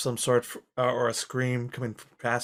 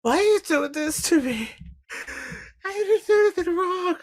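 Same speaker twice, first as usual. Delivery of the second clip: high, desperate cry from a captive; pleading torture victim; 3 dB louder than usual. Acted. Victim crying out to the torturer behind the door, pleading. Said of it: "but you still hear".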